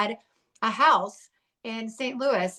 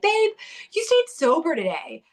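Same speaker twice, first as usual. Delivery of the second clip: much higher, louder cheering husband; excited praise; 9 semitones higher, 3 dB louder than usual. Retold or imitated. Husband greeting her after a sober day, excited. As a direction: gush over her like it's a huge win.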